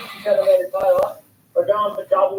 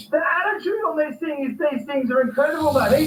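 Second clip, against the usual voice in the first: excited; 7 semitones higher than usual. Real, not acted. Fish hooked and landed.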